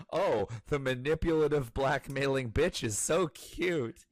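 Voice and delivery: loud, high mocking voice; mocking cohost. Mocking her cohost for calling the blackmailer cute, loud and high. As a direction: mimic the cohost gushing, sarcastic. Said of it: "you're just like".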